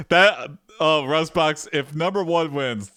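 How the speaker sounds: normal talking voice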